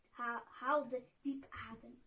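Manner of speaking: ordinary delivery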